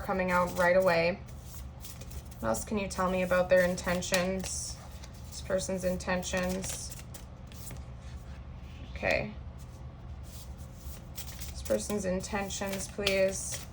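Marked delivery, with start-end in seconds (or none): 5.3-13.6 soft murmured question to the cards; quiet murmur. Real, not acted. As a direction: murmur it under your breath. Asking the cards while shuffling.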